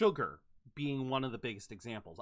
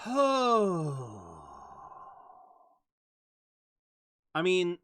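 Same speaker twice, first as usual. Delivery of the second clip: loud sigh; 2 semitones higher, 5 dB louder than usual. Real, not acted. Gamer bracing for a hot take, big sigh. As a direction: sigh hard before speaking.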